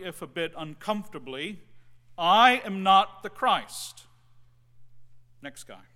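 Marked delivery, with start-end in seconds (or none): 2.2-4.1 loud, slow, forceful "I am not the Christ"; loud declaration. Retold or imitated. Seminary student standing before his peers, loud forceful declaration. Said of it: "said publicly, loudly". 5.4-5.8 much softer throwaway "Next guy."; quiet aside. Acted. Preacher quietly moving to the next student, soft aside. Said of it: "aside between quotes".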